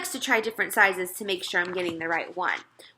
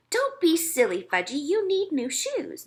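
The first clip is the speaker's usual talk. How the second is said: mother coaxing; 8 semitones higher than usual. A narrator's reading. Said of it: "my mother told him".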